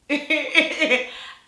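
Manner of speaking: loud, high laugh bursting out; burst of laughter. Real, not acted. Laughing hard recalling his awkward date question. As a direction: crack up at the memory.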